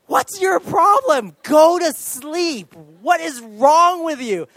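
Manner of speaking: re-enacted furious yell, high and drawn out; full-volume shout. Worn-out dad shouting at his crying baby, full-volume shout. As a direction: shout it slowly, hammering each question. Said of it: "I'm shouting at full volume".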